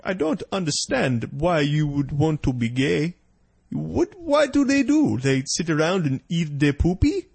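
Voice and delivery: slow, hammed-up Nigerian pastor; mock Nigerian accent. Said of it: "I don't do a very good African voice".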